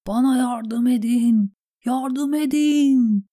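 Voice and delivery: high, loud, drawn-out cries; calling for help. A narrator's reading. Stranded Davos begs sailors for rescue, shouting. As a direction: cry for rescue, building on the last words. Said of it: "diye seslendi tekrar".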